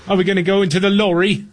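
high, quick mock-British child; playful high-pitched British accent. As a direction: imitate a kid with a British cartoon accent. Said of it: "speaking in British accents".

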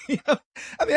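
ordinary talk, nothing marked

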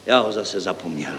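soft, deflated admission; crestfallen. A narrator's reading. Deputy has forgotten his idea, crestfallen. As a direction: admit it quietly, spirits sinking. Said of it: "Sesmutnil zástupce stavebního referenta".

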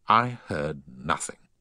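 usual speaking voice

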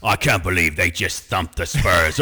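deadpan hard-man growl as the action star; Jason Statham impression. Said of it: "he might as well be like".

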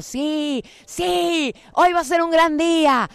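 playful excited yes; happy cheering cells. Pretend. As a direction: cheer like delighted tiny cells. Cells cheering inside your body.

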